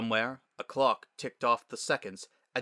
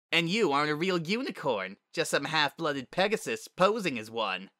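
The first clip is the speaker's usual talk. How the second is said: higher, scornful unicorn bully; sneering school bully; 5 semitones higher than usual. Acted. Bully insults a classmate's mixed parentage, sneering. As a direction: put the half-blood in their place.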